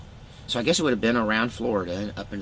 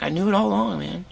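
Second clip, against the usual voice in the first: wry self-quote; 10 semitones higher than usual. Retold or imitated. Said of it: "And I'm like".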